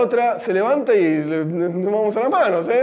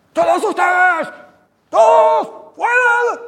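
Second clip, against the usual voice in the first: high furious shout at the class; teacher yelling; 13 semitones higher, 5 dB louder, slower than usual. Retold or imitated. Teacher screaming at her class to get out, furious shout. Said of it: "viene la morá y se pone a gritar".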